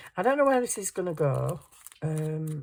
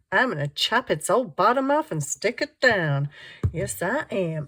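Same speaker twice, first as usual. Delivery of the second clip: put-on American accent; 3 semitones higher, 6 dB louder than usual. Crafter announces her next cut to viewers in playful American. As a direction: slip into a jokey American accent. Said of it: "suddenly went into American mode".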